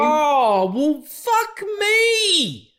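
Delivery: sudden high, loud cry of outrage; outraged shout. Real, not acted. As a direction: blurt it out in real disbelief, a long drawn-out cry. Outraged at show cancellations.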